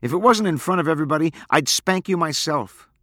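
high, loud threat, slowing at the end; angry. A narrator's reading. Girl threatening to spank her boyfriend, angry. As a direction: scold with real force. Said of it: "Abra's face reddened with anger".

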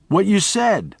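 put-on high, upset boy's outburst; child's accusation. A narrator's reading. Miles as a boy accusing Charlie, high and upset. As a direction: pour out the hurt like a child.

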